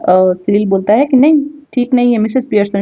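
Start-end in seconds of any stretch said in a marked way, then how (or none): none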